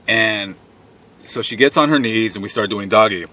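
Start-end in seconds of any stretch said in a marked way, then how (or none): none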